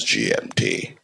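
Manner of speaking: deep mock BBC announcer. Comic mocking British radio time signal, deep announcer. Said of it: "it goes".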